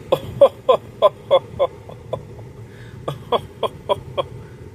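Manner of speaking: high, breathy villain cackle, hammed-up; evil Dracula laugh. Woman mocking Ruben's love life with an evil Dracula laugh. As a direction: cackle like a cartoon vampire mocking him. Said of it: "evil Dracula laugh".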